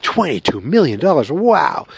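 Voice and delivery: impressed wow with big swooping pitch; exaggerated, sing-song astonishment; mock amazement. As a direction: play awestruck at a huge sum, swinging the pitch up and down. Acting out reaction to big sum.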